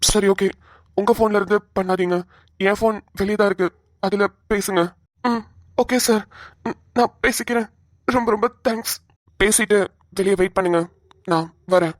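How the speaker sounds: officer voice. Acted. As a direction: reassure him as a calm policeman. Officer voice continues.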